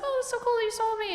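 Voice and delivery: high fake-nice voice; fake-sweet reply. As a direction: chirp it back, overly polite. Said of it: "I was like".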